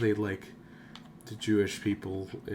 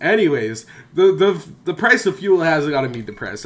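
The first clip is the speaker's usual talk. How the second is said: loud outburst; 7 semitones higher, 12 dB louder than usual. Real, not acted. Sudden loud joking outburst.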